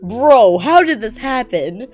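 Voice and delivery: ordinary delivery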